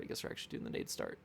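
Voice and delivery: quick whisper under the breath; whispered aside. Real, not acted. Streamer answering his own question, fast whisper. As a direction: mutter it to yourself, fast and voiceless.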